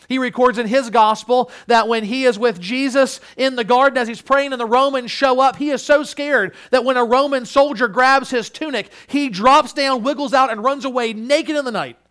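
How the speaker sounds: loud, high, urgent sermon storytelling; forceful preaching. Real, not acted. Preacher recounting Mark's flight from the garden, forceful preaching.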